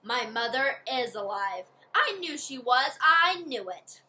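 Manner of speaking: rising joy, ending loud; excited. Acted. Kia's joy at news of mother.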